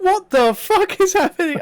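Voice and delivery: high, loud mock shock; shocked disbelief. Retelling his reaction to the Undertaker clip, shocked. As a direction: squeal it in disbelief. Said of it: "I was like".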